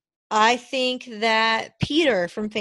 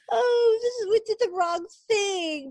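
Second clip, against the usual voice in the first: mock crying lament; 12 semitones higher than usual. Mocking the red woman who burned the girl, fake sobbing. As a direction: parody her weepy remorse. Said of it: "she's all like".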